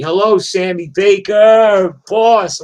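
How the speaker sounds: loud, high, drawn-out hello; shouted greeting. Real, not acted. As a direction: holler hello to someone arriving. Calls out a greeting to someone.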